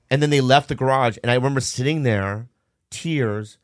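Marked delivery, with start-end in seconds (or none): none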